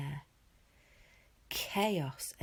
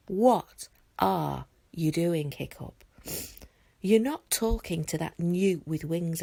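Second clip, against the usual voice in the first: sneering; 9 dB louder than usual. Acted. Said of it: "sneer at Hiccup".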